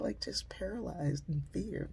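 ordinary delivery

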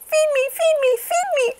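very high, insistent duckling; hungry baby duck. A narrator's reading. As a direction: beg in a tiny squeaky voice. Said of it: "cried the hungry baby duck".

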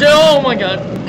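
loud, high, drawn-out shock; real jump-scare outburst; startled. Real, not acted. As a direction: cry out as if ambushed. Startled mid-sentence by the game.

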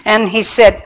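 ordinary talk, nothing marked

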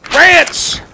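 high, loud announcer yell; big punchy shout; shouted segment title. Acted. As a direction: belt out the show's title word.

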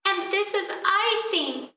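put-on high, thrilled little girl; delighted exclaim. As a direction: squeal it with joy. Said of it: "exclaimed Gretel".

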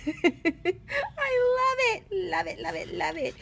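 high, slow, squealing delight; can't contain her joy over the paper; gushing delight. Real, not acted.

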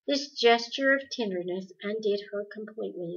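usual speaking voice

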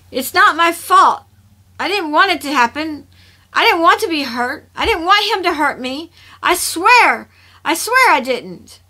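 high, loud, pleading outburst; distraught protest. A narrator's reading. Kirsha pulls against his grip in tears, distraught protest. Tearful character protests innocence.

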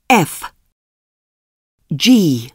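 ordinary delivery

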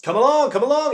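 high, loud impatient call; shouting. A narrator's reading. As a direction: call out loudly to stragglers. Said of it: "he shouted from the dark hole".

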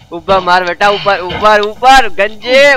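usual speaking voice

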